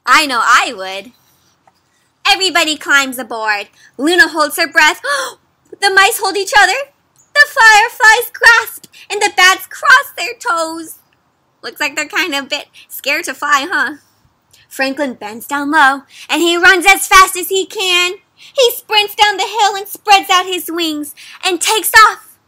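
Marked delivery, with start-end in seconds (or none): 2.2-11.0 rising, louder storybook narration; suspenseful read-aloud. A narrator's reading. Reading to kids before takeoff, building excitement. 14.7-22.4 higher and louder up to the liftoff; building storybook narration. A narrator's reading. Reading the dragon's takeoff to kids, building to a burst.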